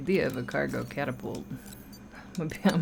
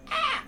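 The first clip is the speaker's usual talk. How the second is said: stretching groan. Real, not acted. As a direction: groan out a stiff-legged stretch. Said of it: "Sorry, I'm stretching real quick".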